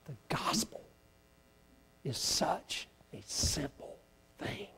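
intimate whisper to the congregation. Real, not acted. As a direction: drop to a breathy secret. Sudden whisper in sermon.

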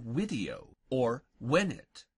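video and venet starting with W; Latin V as W. Shown as a demonstration. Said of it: "pronounced in Latin like our W".

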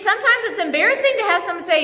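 normal talking voice

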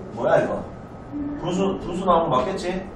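real quiet thinking aloud; puzzled self-talk. Real, not acted. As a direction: check the sum quietly under your voice. Teacher puzzles over own calculation.

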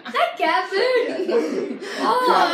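slow, loud laughing revulsion; laughing disgust. Real, not acted. Tastes cat food, laughing disgust.

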